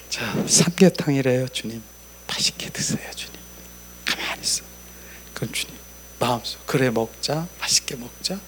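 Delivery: soft, intimate words over a meal; hushed talk with the Lord. Retold or imitated. Preacher shows his quiet table talk with the Lord, hushed. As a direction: murmur to the Lord as to a friend. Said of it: "주님, 오늘 삼계탕이래".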